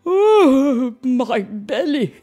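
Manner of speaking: loud, drawn-out moan of pain; wailing. Acted. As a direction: wail it out in pain. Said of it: "he wailed".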